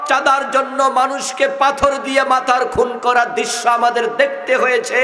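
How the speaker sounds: rising fiery preaching. Real, not acted. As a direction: preach harder line by line. Fiery sermon building to climax.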